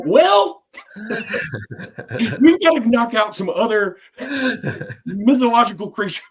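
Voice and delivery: can't get the words out for laughing; laughing through words. Real, not acted. Host telling his co-host the story's punchline, laughing hard and pitched high.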